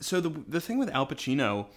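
normal talking voice

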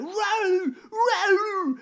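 2: high, loud strangled "Row!" cries; 3: cry "Row!" like a man panicking at a charging bear; 1: weird desperate screams. Retold or imitated. 4: retelling a man screaming at a charging bear: high, loud mock cries. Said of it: "making these really weird noises".

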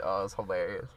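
usual speaking voice

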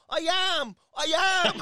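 high, loud protest in an Irish brogue; Irish accent. Retold or imitated. Passenger protesting to a doubting cab driver, loud Irish brogue. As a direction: shout it indignantly in an exaggerated Irish accent. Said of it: "I can put the Irish accent on".

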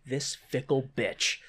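soft, slow, low grumble; quiet acted sulk; bitter aside. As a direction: say it under your breath, resentfully. Earl sulks in the back.

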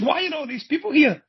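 very high, incredulous question; acted disbelief. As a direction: squeak out the baffled demand. Repeats baffled question, retold.

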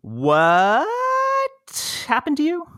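high, startled question; shocked. Real, not acted. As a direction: jump up in alarm. Shocked reaction to fire news.

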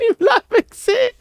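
real laugh while telling it; laughing disbelief. Real, not acted. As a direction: crack up mid-sentence. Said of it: "so etwas noch nie".

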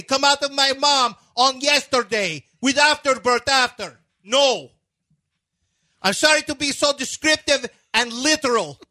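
high, emphatic mock Russian accent; hammed-up Slavic-accented protest in broken English; Darko. Coach apologizing for being literal, high Russian-accented complaint. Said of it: "Your Darko impression is".

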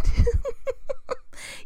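short high-pitched chuckle; amused laugh in mid-story. Real, not acted. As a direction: break into a light, high giggle. Laughs while telling travel story.

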